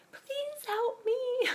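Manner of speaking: very high, slow, faint mock plea; pleading son. Son on the sidewalk begs the stranger, mock pitiful high squeak. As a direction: squeak it out like a helpless kid.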